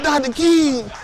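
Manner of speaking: high, drawn-out swoon on Doctor King; mild mock swoon; swooning church lady. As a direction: sigh his name like a starstruck fan. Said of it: "You're like".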